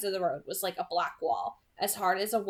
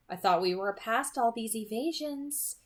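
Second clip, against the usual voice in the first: usual speaking voice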